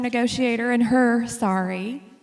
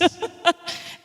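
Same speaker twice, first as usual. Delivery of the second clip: breathy laugh; 8 semitones higher than usual. Real, not acted. Laughing after the punchline.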